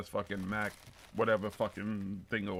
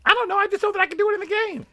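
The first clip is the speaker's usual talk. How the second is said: put-on high-pitched rushed kid voice; high fast kid voice; 10 dB louder, much faster than usual. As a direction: rattle it off like a bratty kid. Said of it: "this kid's out there like".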